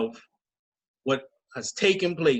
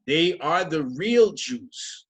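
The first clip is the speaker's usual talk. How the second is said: slow emphatic; 3 dB louder, much slower than usual. Shown as a demonstration. Announcing the night's topic.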